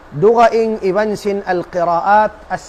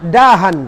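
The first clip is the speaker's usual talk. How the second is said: louder, falling "Dahan" for the class to catch; "Dahan" said louder with a falling tune; emphatic keyword; 2 semitones lower, 6 dB louder than usual. Shown as a demonstration. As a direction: hammer the key term home. Teacher drills key word.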